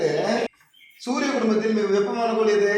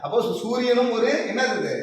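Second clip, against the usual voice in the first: usual speaking voice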